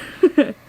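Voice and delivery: short laugh. Real, not acted. Laughing at the book.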